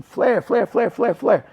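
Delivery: high, loud calls of flare repeated; yelling flare. Retold or imitated. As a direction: call it out across the mat like a coach. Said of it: "Reason I was yelling to them".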